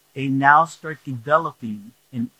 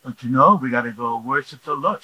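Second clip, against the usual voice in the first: usual speaking voice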